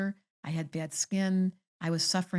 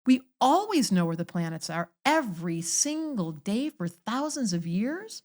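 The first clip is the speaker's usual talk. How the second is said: preaching; 4 semitones higher than usual. Acted. Preaching, slow emphatic.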